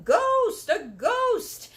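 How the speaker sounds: high, loud panicked yell. A narrator's reading. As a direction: scream in fright at the ghost. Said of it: "with everybody yelling".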